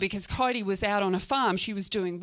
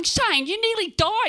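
high, loud protest; exasperated yell; 11 semitones higher, 6 dB louder than usual. Acted. Scolding Shane after he comes to, exasperated yell. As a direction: raise your voice in disbelief. Exasperated retort to revived friend.

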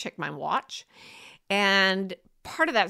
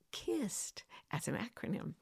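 whispered aside; whispering; 12 dB quieter than usual. Real, not acted. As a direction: breathe it as a secret. Aside about the acronym.

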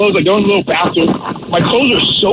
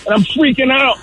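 usual speaking voice

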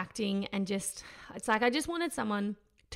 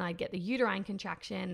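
normal talking voice

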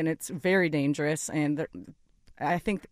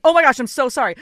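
higher, louder flustered apology; apologetic exclamation; 6 semitones higher, 11 dB louder than usual. Retold or imitated. To the fan she ignored, a flustered louder apology. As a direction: blurt out a sorry, embarrassed. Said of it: "I was like".

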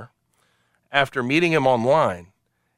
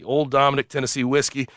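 ordinary delivery

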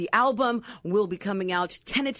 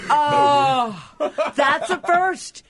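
high, loud delighted exclamation; excited shout; 7 semitones higher, 6 dB louder, much slower than usual. Real, not acted. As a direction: cry out, thrilled and laughing. Delighted by the Ghostbusters answer.

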